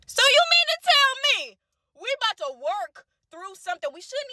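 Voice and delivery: you can't believe what you're hearing; incredulous. Real, not acted. Reacting to his side text, high incredulous. Outraged at the "hey boo" text.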